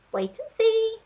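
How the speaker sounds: high cheeky monkey voice; Monkey shouting. A narrator's reading. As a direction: call it out like an excited monkey. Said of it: "shouted the Monkey".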